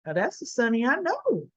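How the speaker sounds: soft, appreciative hmm; a lightly played approving purr; sultry approval. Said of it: "Carly's gonna ... say".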